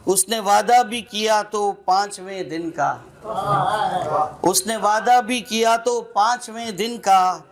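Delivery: recited couplet, repeated with rising force; sher recitation. Acted. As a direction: build the line up for the punchline. (शेर पढ़ने का अंदाज़)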